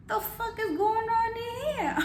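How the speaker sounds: high-pitched incredulous demand, held high; shocked outburst. Retold or imitated. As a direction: retell it as an appalled, high outcry. Retold shocked confrontation at the door.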